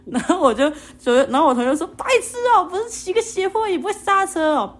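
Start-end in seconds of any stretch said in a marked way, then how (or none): 2.0-4.7 high, teasing scold in a put-on voice; mocking classmate. Classmate jeers at narrator for failing to brake, high mocking voice. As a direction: mimic a classmate jeering at a clumsy friend.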